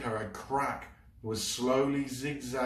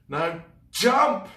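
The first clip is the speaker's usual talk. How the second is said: high loud shout; shouted command; 11 semitones higher, 12 dB louder than usual. Acted. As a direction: urge her to jump now. Urging her to jump.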